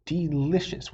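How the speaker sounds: plain reading voice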